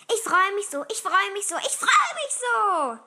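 put-on high, loud little-boy yelling; overexcited kid hollering; excited shouting. A narrator's reading. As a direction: shout with bursting joy, stretching the last one. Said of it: "schrie er durchs ganze Haus".

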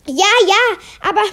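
ordinary delivery

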